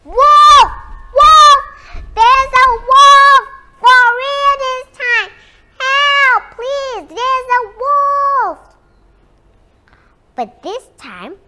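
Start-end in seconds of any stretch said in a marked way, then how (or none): 0.0-8.6 high, desperate full-volume yell; very loud, high cries of alarm; shouting as loudly as possible. A narrator's reading. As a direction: scream for help with all your might. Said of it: "he shouted as loudly as he could".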